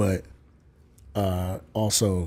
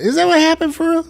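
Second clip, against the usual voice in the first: much higher, louder disbelief; incredulous; 8 dB louder, faster than usual. Real, not acted. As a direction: ask it in shock.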